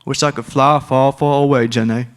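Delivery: a light nod to Forrest Gump's wistful line. Retold or imitated. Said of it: "That might be Forrest Gump".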